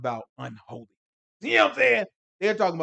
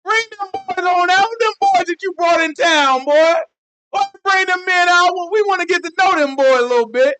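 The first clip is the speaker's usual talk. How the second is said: rowdy mob shout; 12 dB louder than usual. Retold or imitated. The Sodom mob hollering at Lot for his guests. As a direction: bellow at Lot like a crowd of country toughs. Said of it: "They said".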